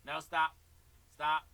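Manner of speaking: sharp, loud call to stop. Real, not acted. Teacher stopping the student's wrong pattern, loud. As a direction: shout over the kit to halt him.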